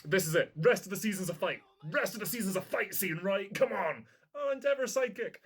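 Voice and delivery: buzzing with anticipation; real hype. Real, not acted. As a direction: rattle it off as an amped-up fan. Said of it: "I'm getting hyped".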